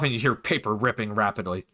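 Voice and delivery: ordinary delivery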